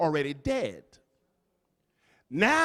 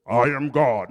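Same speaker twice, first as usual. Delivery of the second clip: James Earl Jones impression; 5 semitones lower, 4 dB louder than usual. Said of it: "sound like the actor James Earl Jones".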